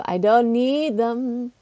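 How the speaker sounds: "I don't need them" slow with weight on "need"; drawn-out repeat. Shown as a demonstration. Streamer groaning at raid bosses, slowly drawn out.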